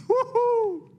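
high, loud "woo-hoo" with a laugh; gleeful whoop. Retold or imitated. Retelling shovelling with mom, gleeful whoop.

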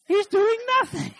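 very high, loud comic outburst; exclaimed punchline. Acted. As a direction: squeal the punchline at full pitch. Comic punchline about the idle husband.

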